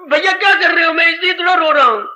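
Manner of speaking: high, pleading voice of a younger brother; acted tearful plea; tearful Lakshman. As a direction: protest to your brother as if near tears. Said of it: "रो के, रोता जा रहा है".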